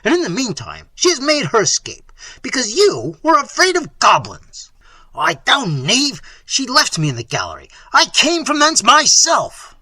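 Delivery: loud, high, furious reproach; prince's voice climbing in exasperation; angry cry. A narrator's reading. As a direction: berate the cowardly servants. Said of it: "cried Manfred".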